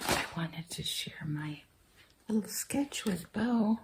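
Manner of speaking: quietly whispered aside. Real, not acted. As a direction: hush it like a secret.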